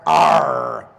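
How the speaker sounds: loud pirate Arr; pirate growl. Pretend. Trainer delivering the pirate punchline, loud and hammy. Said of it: "What does a pirate say?".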